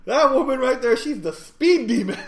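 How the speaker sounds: high, loud accusation through laughter; mock outrage. Shaken passenger accusing the driver, laughing mock outrage. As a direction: cry out in joking horror.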